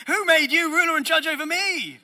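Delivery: high, loud, outraged yell; indignant shout. Retold or imitated. Preacher voicing a child shouting at a bossy sibling, indignant yell. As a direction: yell back like a furious sibling.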